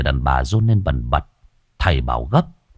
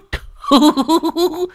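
boastful cackle. Acted. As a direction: cackle like a proud old man. Old man laughs boastfully. (cười khoái chí)

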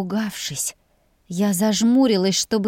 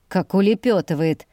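plain reading voice

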